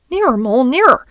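high, loud urging; urgent. Acted. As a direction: call out eagerly, rising on the last word. Rat urgently calls Mole nearer.

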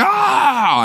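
high yelp of pain, played for laughs; hammed-up ouch; mock pained yelp. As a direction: squeal like you just fell. Mock scream of pain falling.

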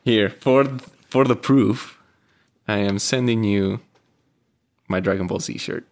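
ordinary talk, nothing marked

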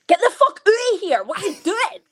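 high, loud Scottish outburst; real Scottish accent breaking out. Retold or imitated. Yelling at the girl who burst into the session, in high, loud Scottish. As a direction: yell it in broad Scottish, the posh act dropped. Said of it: "went from talking like this to being like".

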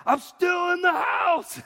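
high, slow, drawn-out shout; loud mock yell across the house; yelling back. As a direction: imitate an exasperated wife hollering back. Wife yells back in retold story.

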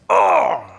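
sudden loud, high cry of frustration; exasperated groan. Acted. Angry worker in a moment with God, exasperated groan. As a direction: burst out in a wordless groan. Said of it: "one of those moments with God where just like".

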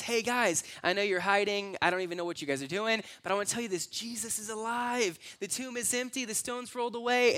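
high-pitched women bringing big news; excited announcement. Retold or imitated. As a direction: play the women urgently telling the guys. Women announce resurrection to the men.

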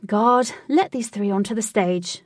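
loud opening, easing into an order; commanding call. A narrator's reading. Triumphant command to the guard.

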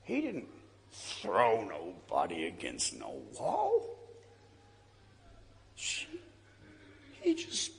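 put-on old woman's voice. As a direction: play the old woman gently setting him straight. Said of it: "She said".

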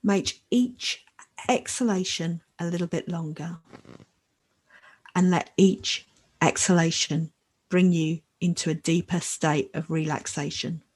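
calm and unhurried, long pauses; guided meditation. Acted. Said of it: "So now I'd like to lead us in a short new moon meditation".

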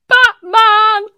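put-on high, loud little boy voice; child's eager answer. Teacher voicing the boy naming his story, high and loud. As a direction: say it like a small boy bursting to say it.

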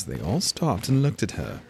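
usual speaking voice

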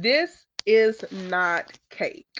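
"This is not cake" spaced out word by word; slow emphatic correction. Retold or imitated. Mom correcting the parking attendant, "This is not cake" slow and emphatic. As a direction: land each word of "This is not cake" slowly and firmly.

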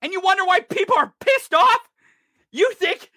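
high-pitched incredulous challenge; exasperated, real indignation; angry disbelief. Real, not acted. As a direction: throw the question at them, pitch climbing.

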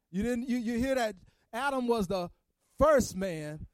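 Good Friday preacher pushing toward the climax; preaching. Acted. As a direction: preach it to the congregation, gathering steam.

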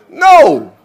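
sharp loud refusal; firm no. Real, not acted. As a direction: shut the hawing down. Cuts off hesitation firmly.

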